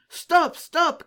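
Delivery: high, loud cry of alarm; soldiers' shout. A narrator's reading. Soldiers running to their weapons, shouting after fugitives. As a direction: shout it high, as if giving chase. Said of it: "crièrent les soldats".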